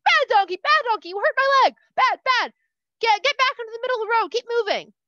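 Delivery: loud, high, furious scolding; angry scolding. Acted. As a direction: berate the donkey at full volume, speeding up. Bilam beating and scolding donkey.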